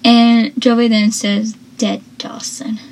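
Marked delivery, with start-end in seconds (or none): 1.8-2.8 quiet imitated menace; muttered threat. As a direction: say it under your breath. Imitating Joey's threat to Dawson.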